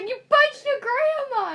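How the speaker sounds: high, loud shocked accusation; mock outraged shout. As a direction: shout it like a stunned bystander. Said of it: "someone just is like".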